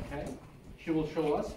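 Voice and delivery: ordinary delivery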